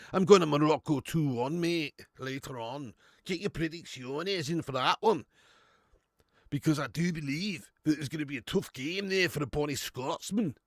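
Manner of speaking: broad, hammed-up Scottish accent; mock Scottish accent. Streamer playing a Scottish pundit predicting the match, mock Scottish accent. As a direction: put on a jokey Scots voice. Scottish bit after opening an Irn-Bru.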